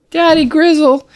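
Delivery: high cub voice calling daddy; a small cub turning to his father; little cub. Acted. Pip speaks up to Daddy Grizzle in a high cub voice.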